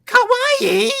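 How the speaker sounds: very high, loud cutesy squeal; squeaky anime voice. Retold or imitated. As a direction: squeal it like a cute anime girl. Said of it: "little Karibo's tail voice".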